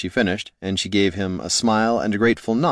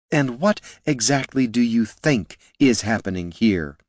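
hissing; 3 semitones higher than usual. A narrator's reading. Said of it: "she hissed".

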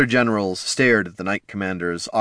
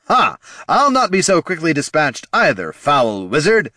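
loud, higher hero's cry; defiant heroic shout; 8 semitones higher, 5 dB louder than usual. A narrator's reading. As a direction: bellow back in defiance. Paladin king defiant.

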